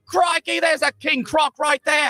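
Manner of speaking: high, drawn-out fake Australian exclamation; bad Australian accent. Australian rider at the tippity top, hammed-up bad accent. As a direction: ham up a cartoon Australian tourist. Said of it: "that Australian dude's like".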